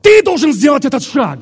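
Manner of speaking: high, full-force preacher's shout; impassioned preaching. Real, not acted. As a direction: shout the demand at the congregation.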